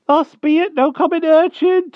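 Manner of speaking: put-on a frightened old hedgehog begging humbly; timid hedgehog voice. A narrator's reading. Hedgehog begging the Wart for mercy, high timid rustic voice. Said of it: "quavered the poor creature".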